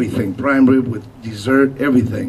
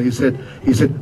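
ordinary delivery

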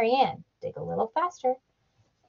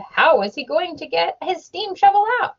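questioning Henry B. Swap; 7 semitones higher than usual. A narrator's reading.

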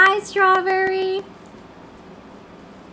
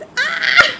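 sudden high laughing shriek; short laughing scream; startled laughing yelp; 9 semitones higher, 6 dB louder than usual. Real, not acted. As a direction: squeal at the surprise. Game surprise.

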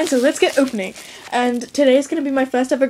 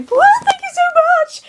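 very high, loud whooping thanks; a very high, loud whoop of thanks to the trader; excited whoop; 6 dB louder than usual. Real, not acted. Whoop of gratitude.

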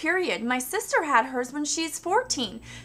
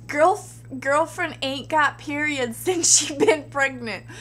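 ordinary talk, nothing marked

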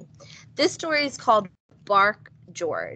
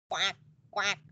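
duck quack; 3 semitones lower, 7 dB quieter than usual. A narrator's reading. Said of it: "And George went".

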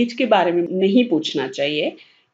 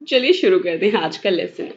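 ordinary delivery

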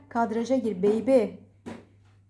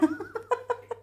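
high, softer giggle; laugh; 8 semitones higher, 7 dB quieter than usual. Real, not acted. Amused at the gathered pets. (hafif, tiz kıkırdama)

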